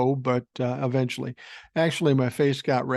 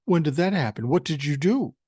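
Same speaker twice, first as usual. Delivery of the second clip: mock-accusing, pitched up a little; smart-ass retort; 3 semitones higher, 4 dB louder than usual. Patient to doctor about a bald spot, smart-ass mock alarm. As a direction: play the wise guy questioning his doctor. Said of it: "being a smart ass".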